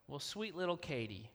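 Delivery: ordinary delivery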